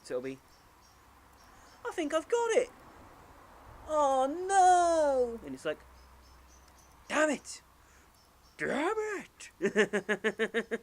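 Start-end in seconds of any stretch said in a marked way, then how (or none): 1.9-5.4 put-on very high, loud squeaky little boy; hammed-up kid, eager then wailing dismay; Luke, childish. Boy sure of his puzzle answer, then wrong, childish. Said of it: "Luke does it in a very childish way". 7.1-10.8 put-on high, loud boyish cursing; mock tantrum in a squeaky kid voice; Luke, childish frustration. Boy who got the puzzle wrong, childishly frustrated. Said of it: "Luke does it in a very childish way".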